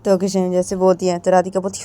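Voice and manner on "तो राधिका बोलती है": usual speaking voice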